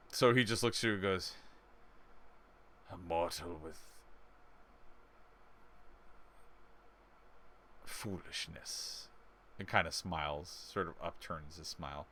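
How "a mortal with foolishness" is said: low, soft, slow otherworldly voice; ominous entity. Acted. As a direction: speak like an ancient being, hushed and low.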